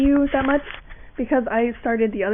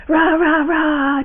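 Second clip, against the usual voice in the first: mock scream; 4 semitones higher, 4 dB louder, much slower than usual. Shown as a demonstration. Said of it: "you wanna start screaming like".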